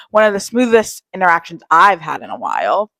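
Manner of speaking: ordinary talk, nothing marked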